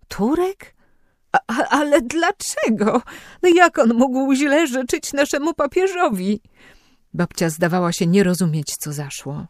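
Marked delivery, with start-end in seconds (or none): 0.1-6.4 put-on high, old woman's startled voice, much higher; an old woman who can't believe it; grandmother, high and aghast; shocked grandmother. A narrator's reading.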